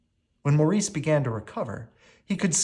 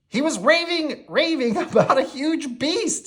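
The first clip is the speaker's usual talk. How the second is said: high, loud jeering sidekick with a laugh; mocking LeFou; 4 dB louder than usual. A narrator's reading. LeFou mocking Maurice in front of the villagers. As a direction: sneer and giggle at the madman. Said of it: "said LeFou".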